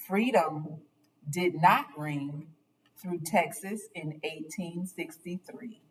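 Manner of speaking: ordinary delivery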